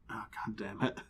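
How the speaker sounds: whispered, weary curse. Acted. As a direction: whisper it under your breath. Buffy faces rushing demons.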